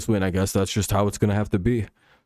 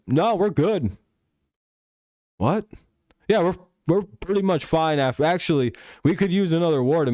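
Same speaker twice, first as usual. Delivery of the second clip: higher, offhand dismissal; brushing off an offer of help, pitched up; mock breezy America; 6 semitones higher than usual. America brushing off an offer of help, breezy and pitched up.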